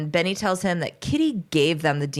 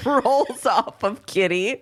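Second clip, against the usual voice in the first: normal talking voice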